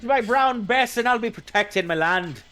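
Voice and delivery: loud, high put-on settler voice; mock colonist. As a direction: crow like a hammed-up colonial gun owner. Acting a colonist with a musket.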